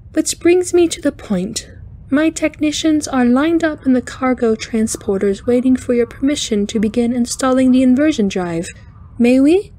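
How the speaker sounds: high, chiming eager alien; cheerful intensity. A narrator's reading. Alien engineer asking the captain's permission, cheerful intensity. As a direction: press on keenly, pitched high. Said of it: "amused by the Hamalki's cheerful intensity".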